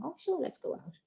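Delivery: high, soft, breezy agreement; the other person. Acted. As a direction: answer like a delighted friend. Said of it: "the other person is saying".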